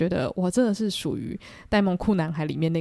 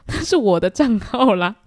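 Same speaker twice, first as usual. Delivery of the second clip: louder, slowed, laughing protest; friend protesting; 3 semitones higher, 7 dB louder, slower than usual. Retold or imitated. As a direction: tell her it's your own account, exasperated. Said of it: "因为我朋友就说". (朋友大声又无奈地抗议)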